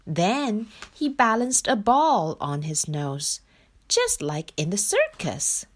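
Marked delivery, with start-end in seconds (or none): none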